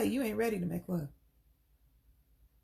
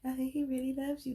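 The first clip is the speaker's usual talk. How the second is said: higher, cooing gush; gushing church ladies; 5 semitones higher than usual. Retold or imitated. Church women gushing to Dolores about her husband. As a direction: coo over her as if swooning. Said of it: "And the women be like".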